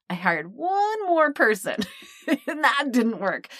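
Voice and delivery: slow, laughing admission. Real, not acted. Confessing yet another failed agency, laughing. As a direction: laugh at your own mistake as you say it.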